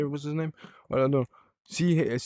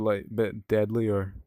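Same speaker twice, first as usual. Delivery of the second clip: ordinary talk, nothing marked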